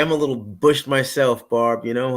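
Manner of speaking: usual speaking voice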